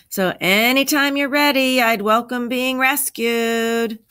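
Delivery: high, stretched-out holler; loud, sing-out complaint from the lost man; loud calling voice. A narrator's reading. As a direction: call for rescue, dragging out the last words. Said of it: "came a very loud voice".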